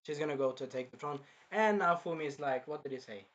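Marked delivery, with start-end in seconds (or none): none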